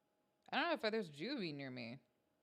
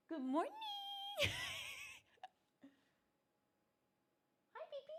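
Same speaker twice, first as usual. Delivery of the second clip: very high, cooing pet voice; squeaky-high doting greeting; 5 dB quieter, faster than usual. Real, not acted. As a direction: coo sweetly to a beloved kitty. Cooing greeting to her cat.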